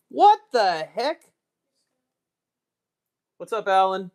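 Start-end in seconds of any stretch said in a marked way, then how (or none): 0.1-1.2 exasperated what the heck. Real, not acted. Frustrated at losing the accent.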